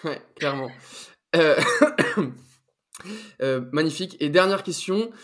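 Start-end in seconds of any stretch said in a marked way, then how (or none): 1.6-2.3 sudden choking cough; coughing fit. Real, not acted. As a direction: cough as if something went down wrong. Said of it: "Je m'étouffe". (quinte de toux)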